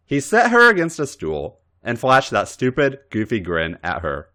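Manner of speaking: plain reading voice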